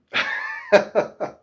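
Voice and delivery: a quick airy laugh at her own debt; breathy giggle. Real, not acted. Laughing at herself over the credit card bill.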